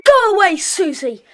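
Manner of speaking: put-on boy shouting his friend's sister away; angry shout. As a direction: drive the pest away. Chasing Susie off.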